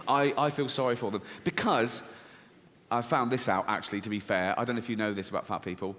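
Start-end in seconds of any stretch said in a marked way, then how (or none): none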